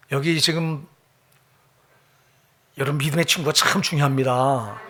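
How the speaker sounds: normal talking voice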